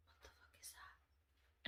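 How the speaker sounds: slow, alarmed whisper. Retold or imitated. Startled by a noise behind her, slow whisper. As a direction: hiss it under your breath.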